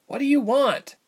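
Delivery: high, urgent question; alarmed captive. A narrator's reading. Ilse surrounded by creatures, high alarmed demand. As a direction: plead for an answer, pitched high.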